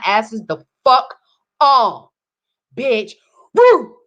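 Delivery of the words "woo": high, loud whoop of triumph; hyped cheer. Real, not acted. Hyped mid-rant cheer.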